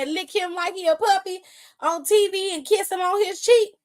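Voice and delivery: ordinary delivery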